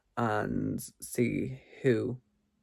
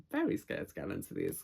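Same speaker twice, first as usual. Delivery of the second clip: ordinary talk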